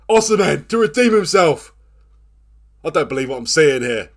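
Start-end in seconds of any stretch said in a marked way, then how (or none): 0.1-1.7 high, loud anxious build-up; tense excitement. Real, not acted. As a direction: call it with bated breath. Penalty about to be taken.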